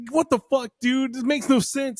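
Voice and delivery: mock confused outburst; mock baffled. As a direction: toss it up high in disbelief. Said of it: "I was like".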